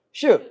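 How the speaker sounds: 슈 starting high and falling sharply; fourth tone. Shown as a demonstration. Teacher drills students on fourth tone, 슈 falling from sol. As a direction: drop 슈 quickly from a high note. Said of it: "솔에서 떨어져".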